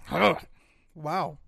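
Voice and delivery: talking while eating; words round a bite of Pop-Tart; mouth full. Real, not acted. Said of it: "Sorry, I'm eating a part, a Pop-Tart right now".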